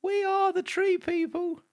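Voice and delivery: put-on very high, slow, stretched childlike voice; high alien voice. Shown as a demonstration. Cohosts recording layers, slow high alien voice. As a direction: squeak it slowly like an eerie child. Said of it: "I'll go high".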